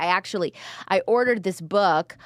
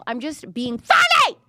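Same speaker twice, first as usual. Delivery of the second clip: joking protest bursting into a shout; loud mock-defensive outburst; playful shout; 7 semitones higher, 5 dB louder than usual. Real, not acted. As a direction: blurt it out high and loud, in jest. Loudly waves off imagined critics.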